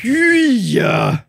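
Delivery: high, loud, drawn-out disgusted yell; mock disgusted shout. Retelling the crowd reacting to the fart, mock disgust. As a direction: stretch out a hammed-up eww.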